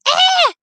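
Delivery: high, loud answering shriek; scream called back. Retold or imitated. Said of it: "trying to mimic the sound that it was making and screaming".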